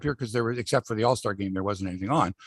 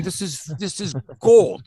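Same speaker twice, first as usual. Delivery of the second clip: delighted; 6 semitones higher, 6 dB louder, slower than usual. Retold or imitated. His own delighted reaction.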